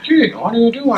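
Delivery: very high-pitched imitation of the bad impression; mock side-mouth voice. Co-host joining the running joke, high mock silly voice. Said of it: "I was talking to a Pakistani and he was like".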